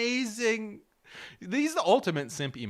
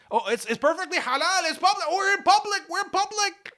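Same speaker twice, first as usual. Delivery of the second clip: much higher, louder, insisting it's public; mock high excuse; 12 semitones higher, 4 dB louder than usual. Brother insisting to sister it's all public, high mock pleading. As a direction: squeak out the excuse, defensive and innocent.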